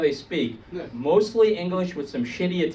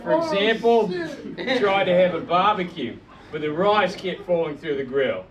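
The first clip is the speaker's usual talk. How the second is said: easygoing fake Aussie accent; put-on Australian accent. Comic claiming an Aussie childhood, put-on Australian accent. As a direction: tell it as a made-up Australian from Brisbane. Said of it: "I've been working on this stupid accent".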